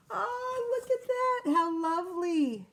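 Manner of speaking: high, gushing delight. Real, not acted. Reader delighted by the cats' cosy party. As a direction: coo over the lovely picture. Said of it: "reader delighted by picture".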